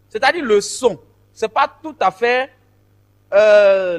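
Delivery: ordinary talk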